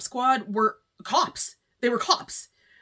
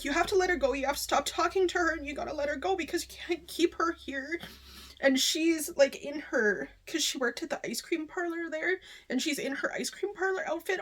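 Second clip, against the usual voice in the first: high and teary, softer, holding back sobs; tearful; 6 semitones higher, 4 dB quieter than usual. Real, not acted. Vlogger retelling the ending to viewers, quietly crying. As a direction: retell the scene through tears. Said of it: "Whatever, I'll just cry".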